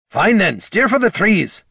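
loud, high shout. Acted. Gorm ordering Jin to steer, shouting. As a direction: yell the order over the wind. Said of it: "shouted Gorm".

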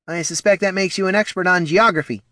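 tart, high-pitched snap; snapped retort. A narrator's reading. Poldo snapping at the exterminator as he leaves. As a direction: cut him down with a quick jab. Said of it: "snapped Poldo".

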